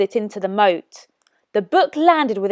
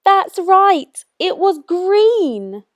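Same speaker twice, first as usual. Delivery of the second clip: teacher praise; 9 semitones higher, much slower than usual. Shown as a demonstration. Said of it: "teacher confirms answer".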